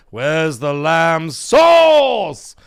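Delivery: slow start erupting into a bellow; very drawn-out loud mock rage shout; Gordon Ramsay freak-out. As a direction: begin measured, then blast the last word. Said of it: "He starts off slow and low".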